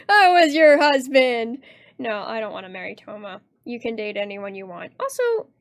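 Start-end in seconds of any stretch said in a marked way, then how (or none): 0.1-1.6 drawn-out, loud mock betrayal; mock outrage. Jilted spouse scolds the two-timer, mock outrage. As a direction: cry it out loud and slow, hammed up.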